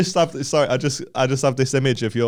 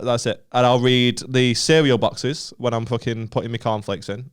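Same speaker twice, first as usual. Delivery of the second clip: normal talking voice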